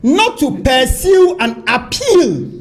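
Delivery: very slow, every word weighed; slow right down to hammer the point home; slow emphatic. Real, not acted. Stresses a key point of the ruling.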